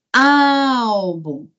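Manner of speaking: ÁÁÁlbum, first syllable held very long; calling the word. Shown as a demonstration. Teacher finding the tonic syllable, calling the word. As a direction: call out Álbum like hailing it.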